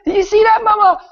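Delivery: put-on very high, loud child voice; amazed little child. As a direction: squeal like an excited kid calling mother. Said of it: "few children, like, pointing".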